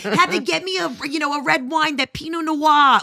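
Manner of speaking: high Boston-accented mock holler; mom screaming across bar. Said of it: "she's screaming across the bar".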